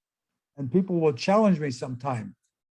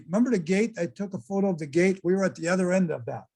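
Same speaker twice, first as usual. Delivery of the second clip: ordinary talk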